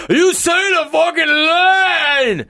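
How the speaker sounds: slow, very high furious yell; Stallone shout. Retold or imitated. Stallone ordering the actor to say the line, slow furious shout. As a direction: bellow the order.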